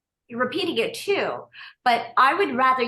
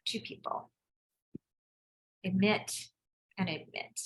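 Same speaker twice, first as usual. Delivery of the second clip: breathy murmur to herself, half under her breath; whispered aside; 3 semitones lower, 9 dB quieter than usual. Real, not acted. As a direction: drop to a whisper as a side note. Muttered aside while admitting people.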